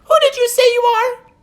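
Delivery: high, loud nervous elf; fretting little elf official; fussy elf voice. Acted. Elf character voice.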